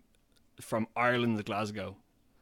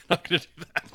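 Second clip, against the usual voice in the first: laughing; 6 semitones higher, 5 dB louder, much faster than usual. Real, not acted. Refuses while laughing.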